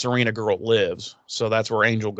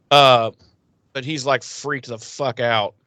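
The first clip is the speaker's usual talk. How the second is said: ordinary delivery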